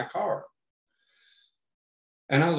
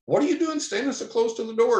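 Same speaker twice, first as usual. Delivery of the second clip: buddy's startled question; 12 semitones higher than usual. Retold or imitated. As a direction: pitch up to play the surprised friend. Buddy voiced in retold story.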